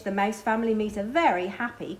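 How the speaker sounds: ordinary delivery, nothing marked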